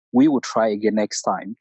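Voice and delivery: usual speaking voice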